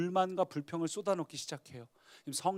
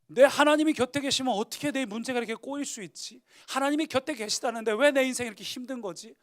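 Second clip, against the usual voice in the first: complaining thoughts; 7 semitones higher, 7 dB louder than usual. Acted. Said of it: "believer's complaining thoughts".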